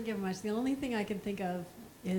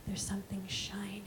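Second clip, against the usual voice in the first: hushed mysterious whisper; mystic fortune teller; 5 dB quieter than usual. Retold or imitated. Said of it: "turn a card over and say".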